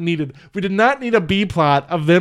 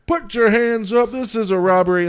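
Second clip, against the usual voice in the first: lightly hammed-up holdup call; mock stickup shout; 7 semitones higher, 3 dB louder than usual. Acting out bank stickup.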